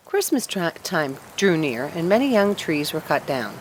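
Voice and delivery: ordinary delivery, nothing marked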